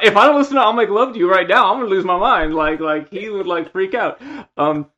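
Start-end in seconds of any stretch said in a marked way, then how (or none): 0.0-2.5 higher, louder, rushed desperate plea; desperate friend. Retold or imitated. Drunk friend demanding his song, desperate plea. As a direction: plead like it's life or death. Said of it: "yeah, like".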